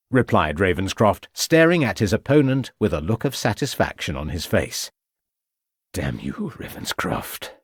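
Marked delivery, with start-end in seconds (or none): 5.9-7.5 much softer, lower, bitter; bitter whisper. A narrator's reading. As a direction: curse him under your breath. Defeated prisoner curses.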